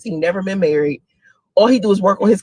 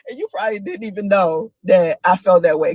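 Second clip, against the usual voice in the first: ordinary delivery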